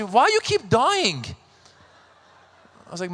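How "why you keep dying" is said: Persian mother. Retold or imitated. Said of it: "My Persian mother".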